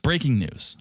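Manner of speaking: ordinary talk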